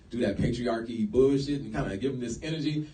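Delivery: normal talking voice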